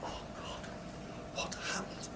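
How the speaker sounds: whispered mortified gasp; embarrassed whisper. Retold or imitated. Red-faced lecturer whispering to himself in embarrassment. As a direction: hush it, caught out and ashamed. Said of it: "Bright red".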